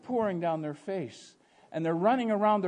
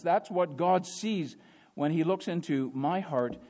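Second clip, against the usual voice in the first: ordinary talk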